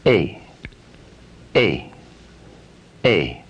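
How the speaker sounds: A gliding forward to E; dynamic vowel A. Shown as a demonstration. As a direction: move A from back to front. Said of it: "the complex sound A is dynamic".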